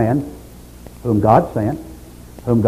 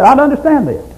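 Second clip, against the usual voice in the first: much higher, louder pleading cry; desperate plea; 12 semitones higher, 4 dB louder than usual. Retold or imitated. Job crying out for a referee, high pleading wail. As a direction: cry it out like a broken man begging. Preacher voicing Job's lament.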